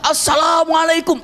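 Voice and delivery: booming drawn-out greeting; loud greeting. Shown as a demonstration. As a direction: call it to the end of the field.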